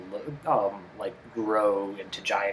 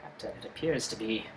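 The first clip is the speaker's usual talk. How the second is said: mock crew report; 4 semitones higher, 9 dB louder than usual. Crew member reporting to the captain, hammed-up reveal. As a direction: hold back, then blurt the last words.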